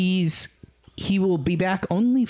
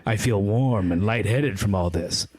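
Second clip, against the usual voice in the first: story narration; 6 semitones lower than usual. A narrator's reading. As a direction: read the romance passage lightly. Reads a fanfic passage aloud.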